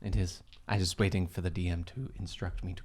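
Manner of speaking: a quiet, prim servant; mock posh butler.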